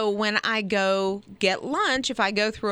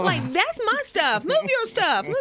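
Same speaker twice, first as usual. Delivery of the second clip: bossy woman; 11 semitones higher than usual. Pretend. Mock bathroom squabble.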